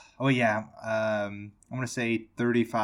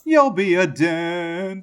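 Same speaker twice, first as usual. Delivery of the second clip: higher, slow drawn-out mom line; slow, higher mock mother; mock movie mom; 6 semitones higher, 4 dB louder, slower than usual. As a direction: stretch it out like the sugary mother.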